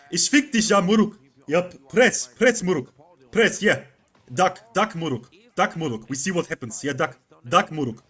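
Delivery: loud, high Zaza-style German Turkish shouting; German Turkish accent. Retold or imitated. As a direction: bark it like a hyped German Turkish gamer. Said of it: "it's like, or Zaza".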